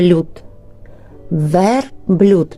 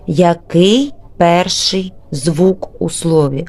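ordinary talk

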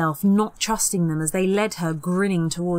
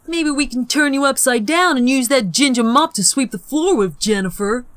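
higher, louder mocking jibe; grinning taunt; 6 semitones higher, 6 dB louder than usual. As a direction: relish the insult with a grin. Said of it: "Peter grinned".

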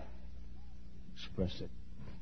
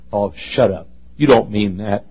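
usual speaking voice

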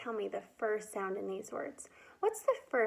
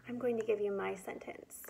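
whispered aside; 2 semitones lower, 6 dB quieter, faster than usual. Real, not acted. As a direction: whisper it to the class. Teacher aside to class.

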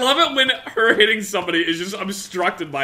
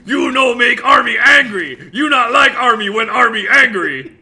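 loud, raised Hulk-style shouting; angry brute; 7 semitones higher, 6 dB louder than usual. Pretend. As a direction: shout a threat like a simple-minded monster. Hulk parody, broken-English threat.

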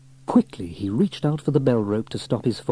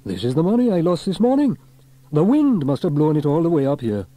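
high, loud, amazed fireman; excited Fireman Sam; 6 semitones higher, 5 dB louder than usual. A narrator's reading. Sam finding his lost money on the roof, excited. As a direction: exclaim over a lucky find.

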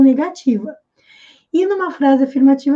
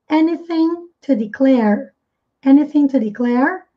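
slow careful demo. Shown as a demonstration. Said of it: "pontinha da língua presa entre os dentes".

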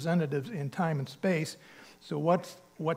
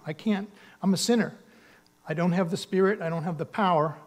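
usual speaking voice

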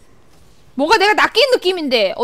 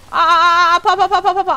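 rapid higher-pitched ows; mock pain cries; 4 semitones higher, 4 dB louder than usual. Player reacting to hits on the character, mock pain cries. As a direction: yelp as your character gets hit. Under attack in game.

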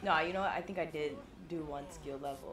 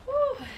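loud high whew of relief; 11 dB louder than usual. Real, not acted. Relief after escape.

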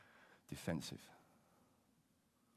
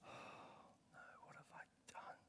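a hushed, horrified whisper; whispered remorse; 23 dB quieter, slower than usual. Pretend. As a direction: breathe it out in dismay. Imagined remorseful Saul in sermon.

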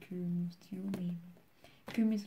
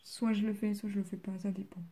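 normal talking voice

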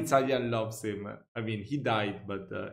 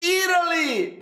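high proud cry of Italy!; excited shout; 3 dB louder than usual. Real, not acted. Reacting to an Italy video, bursts out in excited pride.

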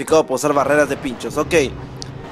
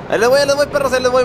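higher, louder charging yell; excited shout; 8 semitones higher, 3 dB louder, much faster than usual. Real, not acted. Player yelling at traffic while driving through it, excited shout.

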